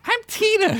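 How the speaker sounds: put-on very high child voice; presumptuous little girl. A narrator's reading. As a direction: announce it as if everyone should know. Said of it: "as if he was supposed to know that".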